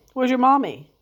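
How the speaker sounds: loud teasing question. Real, not acted. Teasing dog about his mommy.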